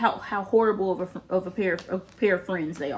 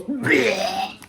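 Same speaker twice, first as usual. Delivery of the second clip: mock retching noise; cartoonish "bleh" of being sick; imitated vomiting; 3 dB louder than usual. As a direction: fake a sudden throw-up sound. Imitates Cassie vomiting in retelling.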